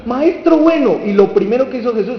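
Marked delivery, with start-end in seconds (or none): 0.1-1.0 high, loud acted call to a teacher; raised-pitch flattering greeting; eager hailing. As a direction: hail the master with showy enthusiasm. Rich man hailing Jesus, acted.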